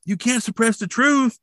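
high-pitched mock protest; acted protest. Blaine grabbed while bursting into autopsy, protesting. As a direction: imitate a zealot crying out.

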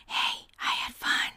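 soft mock whisper in class. Girl whispering to her classmate about the party. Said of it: "whispering about Lauren's birthday".

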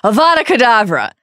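loud, high incantation; Voldemort casting the killing curse, loud. A narrator's reading. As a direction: hurl the curse at him.

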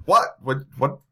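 baffled repeated questions; confused what. Acted. Bystanders confused.

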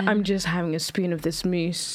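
indulgent, velvety cookery host; Nigella Lawson voice. Retold or imitated. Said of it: "Nigella Lawson coming".

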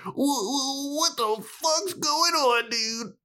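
very high squeaky fake whimper; mock freak-out. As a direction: wail it slowly in a tiny, hammed-up falsetto. Acting out Buffy's escalating confusion.

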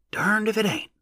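high rustic wonder, falling at the end; subdued exclamation. A narrator's reading. Onlooker amazed at the find, subdued exclamation. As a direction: marvel in a high, restrained voice. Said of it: "a subdued voice exclaimed".

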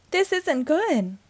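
high, slow worried wail; drawn-out high-pitched lament. A narrator's reading. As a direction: wail it in dismay. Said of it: "Rex wailed from the hallway".

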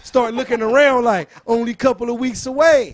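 loud, high trash talk; hyped shouting. Pretend. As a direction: yell it like a battle rapper calling out a rival.

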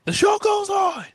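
high, slow, shouted proclamation; announcer shout. Acted. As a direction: declare it like a ring announcer. Announcing show continues.

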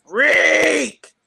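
dad's loud, high call across the room; calling out the name. Retold or imitated. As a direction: call her name out loud like a proud dad. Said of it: "He called me Reek".

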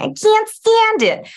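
very high, slow, nasal squeal; high nasal caricature. Retold or imitated. Mocking the old Hollywood caricature, high and nasal. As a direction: squeal it like a silent-film starlet gone shrill. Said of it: "like in Singing of the Rain".

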